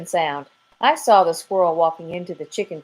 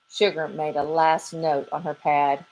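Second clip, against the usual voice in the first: usual speaking voice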